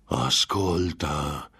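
long drawn-out demon call; tempter calling out. A narrator's reading. Demon halting the hesitant signer, drawn-out call. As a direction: stretch the word out very slowly. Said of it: "vociò il tentatore".

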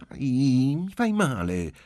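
high, loud whiny "ow"; little girl's prompt, high protest; child complaining of pain. A narrator's reading. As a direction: complain at the first tug. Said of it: "si lamentò la bambina prontamente".

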